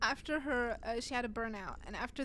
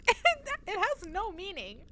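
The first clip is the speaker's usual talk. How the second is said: high, loud, breaking into laughter; real helpless amusement; laughing while talking; 11 semitones higher, 8 dB louder than usual. Real, not acted. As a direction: squeeze the words out through laughter. Laughing at her own story.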